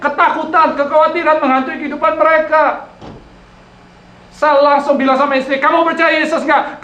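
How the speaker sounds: high, loud, driving sermon delivery; full-force preaching. Real, not acted. Preacher proclaiming Jesus to the congregation, full-force preaching.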